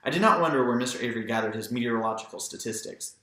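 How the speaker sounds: plain reading voice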